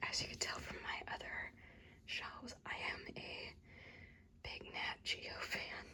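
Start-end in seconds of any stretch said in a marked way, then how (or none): none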